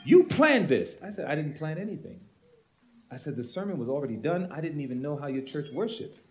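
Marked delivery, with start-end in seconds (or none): 0.1-1.0 higher, louder accusation; accusing pastor. Retold or imitated. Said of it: "pastor accusing the preacher". 1.2-6.2 much softer, gentle explanation; preacher answering the yelling pastor, soft; talking softly. Retold or imitated. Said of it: "Talking softly back to him".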